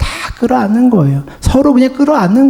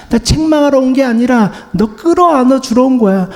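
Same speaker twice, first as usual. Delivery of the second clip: ordinary talk, nothing marked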